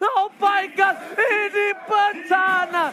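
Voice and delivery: high, loud hype yell; excited shouting. Real, not acted. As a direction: roar it like a stadium caster. Caster erupts at a team wipe.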